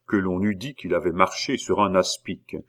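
plain reading voice

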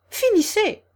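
high, loud, piercing order; shouted. A narrator's reading. Queen halting the bowing gardeners, high shout. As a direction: cry out to stop them. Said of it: "cria la reine".

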